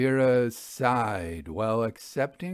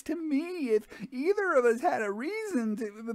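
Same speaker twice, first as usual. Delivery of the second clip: much higher, stammering woman's voice; panicking widow. Acted. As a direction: stumble on the words in alarm. The widow presses Houdini, panicking.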